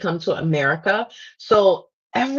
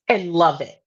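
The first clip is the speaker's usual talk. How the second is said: ordinary delivery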